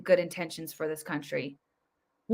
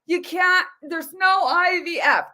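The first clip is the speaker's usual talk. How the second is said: high, loud mock alarm; shrill-free mock outrage, pitched way up; mocking the administration; 9 semitones higher, 6 dB louder than usual. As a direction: squeal it like fake panic. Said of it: "Just totally lying".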